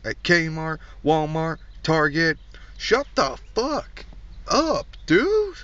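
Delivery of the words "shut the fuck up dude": high, slow drawn-out squeal; squeaky mock yell. Comic yells at the defendant in a high squeaky voice.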